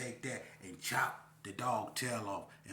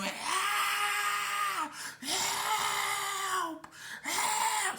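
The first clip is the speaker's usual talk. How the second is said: long, very high cries for help; retold scream; 4 dB louder, much slower than usual. Storyteller reenacts being punched at the window, screaming for help. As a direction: scream it out high and loud, drawing each one out. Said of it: "I'm screaming".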